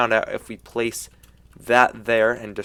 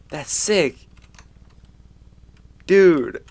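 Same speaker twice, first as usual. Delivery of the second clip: hyped, high and loud; frat bro; 3 semitones higher, 5 dB louder than usual. Real, not acted. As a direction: gamer losing it over a cool texture. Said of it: "That was my reaction".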